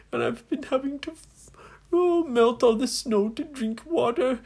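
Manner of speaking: high-pitched, self-pitying wail; hammed-up tearful complaining; whining lament. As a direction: pour out woes in a put-on high voice. Stranded, crying captain laments his plight.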